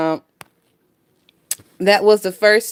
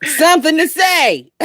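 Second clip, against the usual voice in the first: laughing; 7 semitones higher than usual. Real, not acted. Laughing while repeating clip words.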